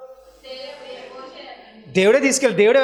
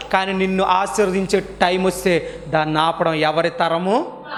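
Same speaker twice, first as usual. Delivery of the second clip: usual speaking voice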